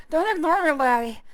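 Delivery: loud, angry sea captain bark, slowing at the end; a drunk captain snapping at the hero for ignoring him; angry pirate captain. Acted. Drunk captain demands to know how much gold, loud and angry. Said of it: "looks angry as he asks again".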